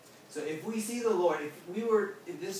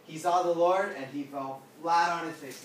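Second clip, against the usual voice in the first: normal talking voice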